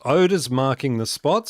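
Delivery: ordinary talk, nothing marked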